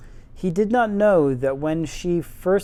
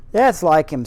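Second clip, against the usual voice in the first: a gruff Yorkshire snap, louder; faux Yorkshire accent; 6 dB louder than usual. Gardener snapping at the girl in faux Yorkshire. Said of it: "my terrible faux Yorkshire accent".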